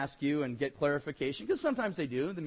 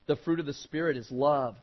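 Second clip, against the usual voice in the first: usual speaking voice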